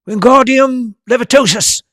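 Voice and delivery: high, loud shouted incantation; shouted spell. A narrator's reading. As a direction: yell the spell at the feather. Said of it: "he shouted".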